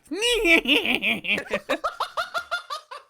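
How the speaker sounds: long, very high puppet cackle; mock villain cackle. Co-host as the Minecraft doll, high mock-evil cackle. Playing the doll's villain laugh.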